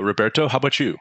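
ordinary delivery

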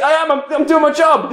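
high, frantic mock protest; retold manic comeback; indignant retort.

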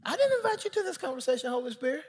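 high-pitched, quick indignant squeak; mock protest. Pastor objecting to the uninvited Holy Spirit, mock protest.

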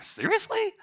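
very high disbelieving squeak; incredulous. Retold or imitated. Reader reacting to the Israelites, high incredulous squeak. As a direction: act floored by it. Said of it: "you're like".

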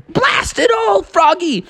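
very high, cross shout; angry sister. Acted. Sister woken up, shouting at Froggy. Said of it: "And Sister woke up and said".

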